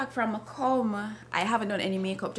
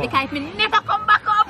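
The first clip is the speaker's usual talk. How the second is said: ordinary delivery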